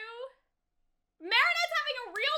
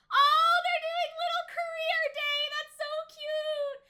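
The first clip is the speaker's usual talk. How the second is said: gushing squeal; 5 semitones higher, 3 dB louder than usual. Real, not acted. Fan gushing at cute scene.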